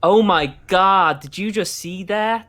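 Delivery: loud, slow, hammed-up American drawl; excited American tourist voice; mock American accent. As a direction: go full over-the-top American. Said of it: "try to do an American accent".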